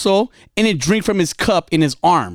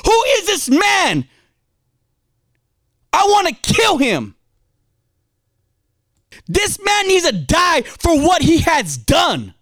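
acted outrage, pitched up without extra volume; furious King David; 8 semitones higher than usual. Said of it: "David's getting furious".

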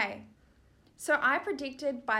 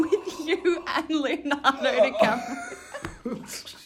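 laughing confession; 4 semitones higher, 4 dB louder, much slower than usual. Real, not acted.

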